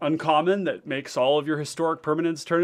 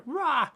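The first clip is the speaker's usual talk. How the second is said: playful roar; 13 semitones higher than usual. Real, not acted. Playful outburst about messy hair.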